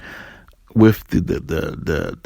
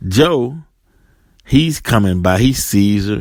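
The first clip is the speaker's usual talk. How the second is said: normal talking voice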